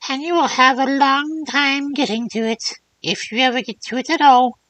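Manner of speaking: put-on old crone voice. Third old woman speaks.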